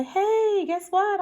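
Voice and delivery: high, playful pretend news-breaking; mock excited announcement. Girl pretending to tell her parents, mock excited. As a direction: pitch it way up, as if bursting with news.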